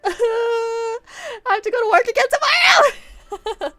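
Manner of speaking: very high, loud fake crying; mock wailing. Complaining about work again tomorrow, mock sobbing shout. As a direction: wail it out, hammed-up.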